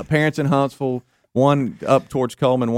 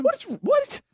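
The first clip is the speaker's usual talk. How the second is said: soft, very high baffled squeak; incredulous squeak; 5 dB quieter than usual. Retold or imitated. Man sputters about early riser, high incredulous squeak. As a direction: sputter in tiny high disbelief.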